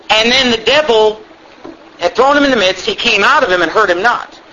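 ordinary delivery

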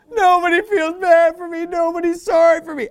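high, whiny mock wail; mock self-pity. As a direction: whine it as a hammed-up pity party. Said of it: "just feeling sorry for himself even publicly".

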